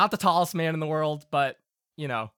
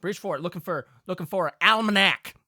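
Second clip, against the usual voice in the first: indignant folksy father spinning a yarn; storytelling Southern Pa; 4 semitones higher than usual. Acted. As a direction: tell a country tale of near disaster. Game master plays Pa.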